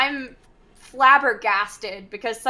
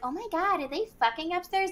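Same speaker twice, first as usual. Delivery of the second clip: imitating her in a high, lightened voice; high-pitched mock astonishment; mock scandalized quote; 7 semitones higher, 4 dB quieter than usual.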